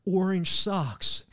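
slow, drawn-out American "Orange sucks"; deliberate American pronunciation demo; American accent. Shown as a demonstration. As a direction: stretch both words in careful American. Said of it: "really hard to get".

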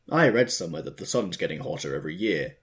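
usual speaking voice

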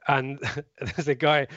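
ordinary talk

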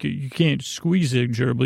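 ordinary delivery

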